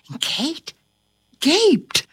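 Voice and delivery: plain reading voice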